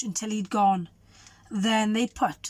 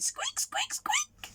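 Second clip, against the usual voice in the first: tiny high whispered squeak; whispered mouse squeaks; 8 dB quieter, slower than usual. Acted.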